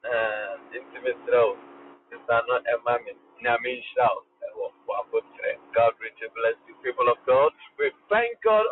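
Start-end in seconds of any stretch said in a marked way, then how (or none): none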